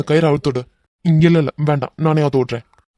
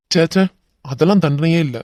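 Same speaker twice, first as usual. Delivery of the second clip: usual speaking voice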